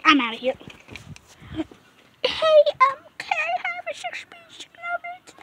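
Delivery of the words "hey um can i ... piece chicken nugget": very high, thin put-on voice; high-pitched pretend customer; squeaky customer voice. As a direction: order in a tiny squeak.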